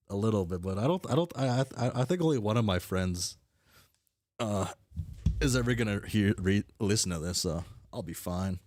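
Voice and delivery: usual speaking voice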